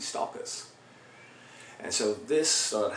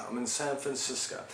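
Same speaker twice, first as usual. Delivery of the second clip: usual speaking voice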